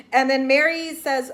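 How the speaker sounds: ordinary delivery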